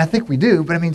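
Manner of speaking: ordinary talk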